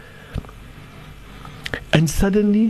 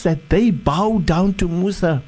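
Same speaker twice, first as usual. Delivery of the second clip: ordinary talk, nothing marked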